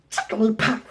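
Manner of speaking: high squeaky Pokemon cry; Jigglypuff impression. Demonstrating his Jigglypuff impression for the judges. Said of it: "I'm also really good at Jigglypuff impressions".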